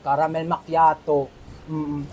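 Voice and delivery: very soft low savoring tone; sultry low. Acted. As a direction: purr it like an ad. Barista savoring coffee sultry.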